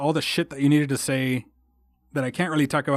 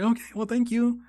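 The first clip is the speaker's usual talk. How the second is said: much higher, hesitant grateful reply; shy thanks; 9 semitones higher than usual. Retold or imitated. Loner thanking welcoming group, high and hesitant. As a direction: accept the invite a little awkwardly. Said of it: "I was like".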